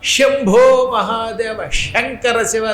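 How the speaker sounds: శంభో said with a retroflex ష sound, slow and drawn out; mock the wrong sha in శంభో మహాదేవ, slowly; mispronounced Shiva names. Shown as a demonstration. Preacher mocking devotees who say శంభో with the wrong sha. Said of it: "కొంతమంది శివ అనమంటే శివ అంటారు".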